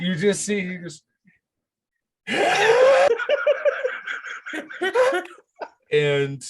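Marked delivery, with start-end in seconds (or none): 2.3-5.3 big high shrieking laugh; loud laughing fit. Real, not acted. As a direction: burst out laughing at the roll. DM bursts out laughing.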